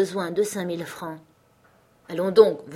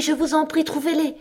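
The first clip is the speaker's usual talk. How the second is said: desperate plea; 9 semitones higher than usual. A narrator's reading. Cousin begging her cousin for money, higher desperate plea. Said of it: "poussa une sorte de cri de souffrance".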